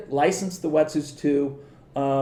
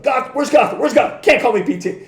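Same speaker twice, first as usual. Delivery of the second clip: high, loud triumphant call; excited shout; 8 semitones higher, 6 dB louder than usual. Retold or imitated. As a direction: yell it out holding the trophy. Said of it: "He's like".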